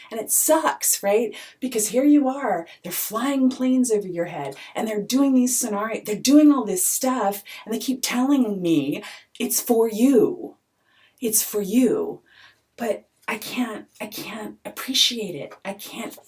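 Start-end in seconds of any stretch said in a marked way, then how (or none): 0.1-10.6 louder and higher, venting hard; frustrated outburst. Real, not acted. 11.2-12.2 bitter repeat. Real, not acted. Bitter echo of the claim.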